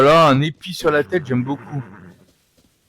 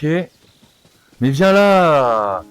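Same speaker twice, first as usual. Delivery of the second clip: coaxing call; 5 semitones higher, 6 dB louder, much slower than usual. Acted. Coaxing a wild horse to come.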